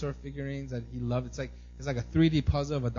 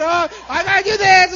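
high, loud, over-the-top excitement; hammed-up thrilled shout; Oprah; 14 dB louder than usual. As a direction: imitate a TV host yelling with excitement. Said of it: "like how Oprah gets so excited".

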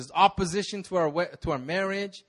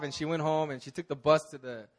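ordinary delivery

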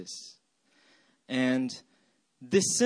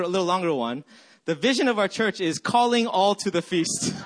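ordinary talk

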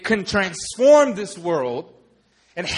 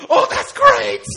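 very high, loud squeal of delight; hammed-up ecstatic yell at bad news; overjoyed George Bailey; 9 dB louder than usual. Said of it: "He is so excited".

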